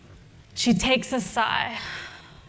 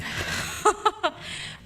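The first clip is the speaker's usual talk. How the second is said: high, breathy laugh at herself; laughing at own bluster; short laugh; 9 semitones higher, 3 dB quieter than usual. Real, not acted.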